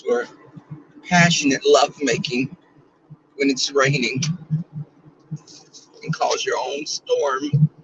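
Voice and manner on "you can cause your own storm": sultry tease. Acted. Talking about lovemaking in the rain.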